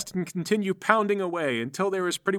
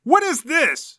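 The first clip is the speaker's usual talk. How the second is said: high, loud outraged shout; yelling; 11 semitones higher, 8 dB louder, slower than usual. Acted. Fighter turns on his associate, yelling. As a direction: yell it as a furious demand. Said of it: "he yells at McGoffel".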